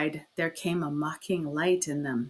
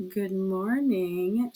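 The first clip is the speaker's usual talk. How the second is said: slow, sugary affected greeting; sugary drawled hello; sweet affected drawl; much slower than usual. Acted. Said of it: "she cried in her sweet, affected drawl".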